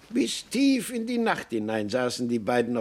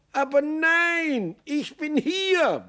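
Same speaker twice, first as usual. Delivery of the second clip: high, slow, drawn-out yell; high-pitched insistent bellow, slowly drawn out; slower than usual. A narrator's reading. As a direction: holler it high, stretching each word. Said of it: "brüllte Maxel".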